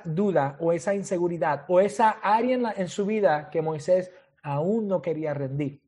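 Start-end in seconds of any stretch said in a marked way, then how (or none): none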